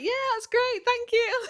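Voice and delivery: very high, strained polite thanks; forced politeness. Retold or imitated. Thanking the family friend who showed up with dogs, forced bright high thanks. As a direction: fake cheerful thank you through tears.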